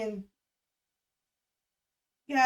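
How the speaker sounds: normal talking voice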